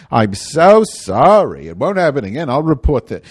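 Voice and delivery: mock a syrupy apology; phone clerk voice. Phone clerk soothing the complainer, loud mock sympathy. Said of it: "same idiot on the phone".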